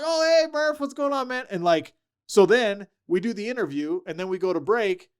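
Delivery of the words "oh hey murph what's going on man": high, cheerful hello; friendly greeting. Retold or imitated. Coach greeting his former player on the radio call, high cheerful hello. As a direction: greet an old buddy brightly.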